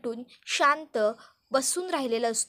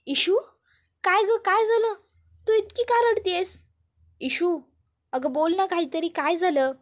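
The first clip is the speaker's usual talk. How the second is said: higher, louder worried questions; anxious alarm; 5 semitones higher, 4 dB louder than usual. Acted. Aunt finds Ishu sobbing, alarmed and worried. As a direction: rush to her, alarmed and anxious.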